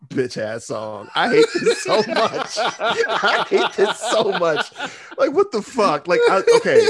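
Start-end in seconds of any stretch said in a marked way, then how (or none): 1.1-6.9 high, loud words through laughter; can't stop cracking up; laughing disbelief. Real, not acted. Laughing at the song.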